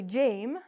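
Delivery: "Jame" with a long A, like James; wrong long vowel. Shown as a demonstration. Teacher showing a mistake, "Jame" with long A. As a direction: say "Jame" to rhyme with game. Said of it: "We don't say".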